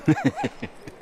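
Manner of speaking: royal laugh. Acted. King laughs at his joke.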